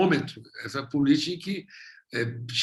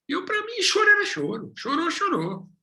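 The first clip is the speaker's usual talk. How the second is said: high, mock-naive shrug; raised, simple-minded tone; naive dismissive; 13 semitones higher than usual. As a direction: play the clueless one who hears all crying alike. Mocking own naive past view.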